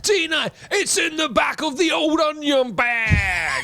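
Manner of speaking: high, drawn-out goal call; excited commentary cry. Acted. Football fan mimics an excited match commentator, exuberant drawn-out cry. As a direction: stretch it out like a thrilled match commentator.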